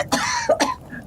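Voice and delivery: hard choking cough; woman choking on smoke coughs; smoky coughing fit. Acted.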